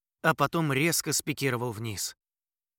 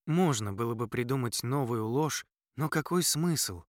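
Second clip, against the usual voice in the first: plain reading voice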